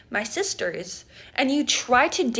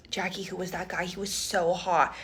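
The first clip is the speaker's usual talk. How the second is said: put-on low, softer, secretive girl talk; hushed gossip; 6 semitones lower, 6 dB quieter than usual. Friend in the bathroom, hushed and swooning over the brother. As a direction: lean in and gush quietly.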